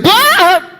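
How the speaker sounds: high, loud yelp of fright; sudden hammed-up shriek; startled scream. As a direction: jump out of your skin. Startled by voice behind him.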